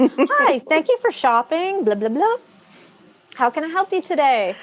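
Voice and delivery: high, bright shop-counter greeting; chirpy, helpful retail greeting; customer service voice. Shown as a demonstration. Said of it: "What's my customer service voice?".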